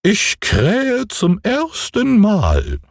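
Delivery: hoch und sehr langsam gerufen; goldener Hahn. Acted. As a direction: stolz herabrufen wie ein Turmhahn. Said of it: "rief der goldene Hahn herunter". (hohe, gedehnte Hahnenstimme)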